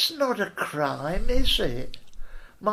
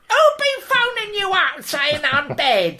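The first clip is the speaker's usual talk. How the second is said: much higher, louder indignant squawk; indignant leader voice; 11 semitones higher, 5 dB louder than usual. Retold or imitated. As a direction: demand it like an outraged dictator. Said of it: "he goes".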